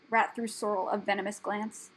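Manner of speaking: plain reading voice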